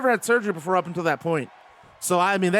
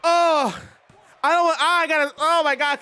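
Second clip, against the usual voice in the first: stunned; 8 semitones higher, 3 dB louder than usual. Real, not acted. Still reeling from the story.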